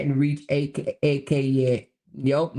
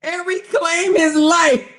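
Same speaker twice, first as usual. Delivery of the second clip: high, slow, loud and falling at the end; loud, drawn-out incredulity; loud mocking repeat; 11 semitones higher, 7 dB louder, slower than usual. Real, not acted. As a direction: belt it out in mocking disbelief. Louder repeat after laughing fit.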